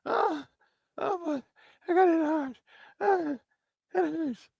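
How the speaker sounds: high, soft mock whine of a hurt player; whiny diva mockery. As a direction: whimper it like a diva faking pain. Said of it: "whining like a little diva".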